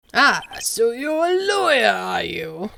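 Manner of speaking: slow, lightly voiced old lawyer; a hint of a pompous old man; slow, self-important old attorney. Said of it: "what should his voice be?".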